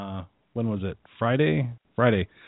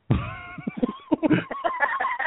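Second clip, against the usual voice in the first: laughing hard, very high; high burst of laughter. Real, not acted. Coach laughing at the vice president's retort, very high. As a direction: crack up.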